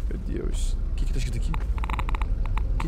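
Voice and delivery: breathy, slow whisper in the dark; creeping tense and wary; hushed whisper. Real, not acted. Gamer creeping through a dark area, hushed whisper.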